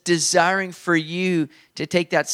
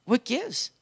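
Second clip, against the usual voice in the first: higher-pitched, mock aggrieved complaint; whiny older brother; 5 semitones higher than usual. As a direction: play the jealous son whining to his father, a few tones up. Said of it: "He's like".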